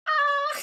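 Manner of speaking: high-pitched yelp; mock scream. Acting him when the party sees his tattoo, high mock scream. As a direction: shriek high as if caught out. Imagined man caught with tattoo.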